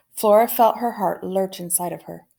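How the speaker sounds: usual speaking voice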